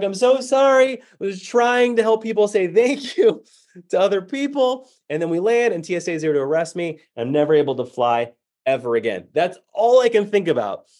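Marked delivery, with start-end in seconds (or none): 0.0-4.9 high, slow, pleading apology; mock-panicked apology to flight crew. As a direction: grovel and beg forgiveness. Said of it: "I'm like".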